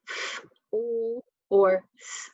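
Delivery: "F, O, or S" as fff, ull, sss; each consonant held alone between gaps; isolated letter sounds. Shown as a demonstration. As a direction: sound out "F, O, or S", not letter names. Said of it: "sound after a short vowel".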